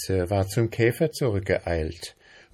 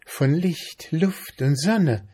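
high, unhurried mayfly voice; dreamy mayfly; 9 semitones higher, 3 dB louder than usual. A narrator's reading. Mayfly saying what she lives on, dreamy. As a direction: savour each thing, pitched up.

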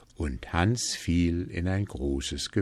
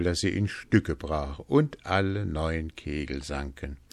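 usual speaking voice

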